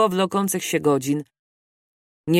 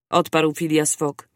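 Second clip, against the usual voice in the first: ordinary delivery, nothing marked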